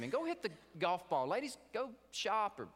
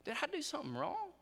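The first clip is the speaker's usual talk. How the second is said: put-on hurt little boy; 10 semitones higher than usual. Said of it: "big tears welled up in his eyes".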